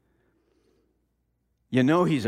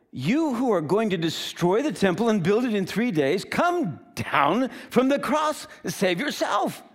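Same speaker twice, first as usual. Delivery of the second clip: high, loud jeer at a condemned man; mocking; 7 semitones higher, 3 dB louder than usual. Passers-by insulting Jesus on the cross, mocking. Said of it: "mocked him among themselves".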